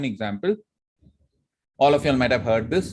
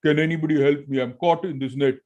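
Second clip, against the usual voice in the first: lion shouting for help; 3 semitones higher than usual. Acted. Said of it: "the lion was shouting".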